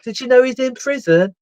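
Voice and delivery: high, chirpy excited question; a hammed-up excited officer asking; mock excited. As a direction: imitate her eager surprise at the news. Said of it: "she was all excited".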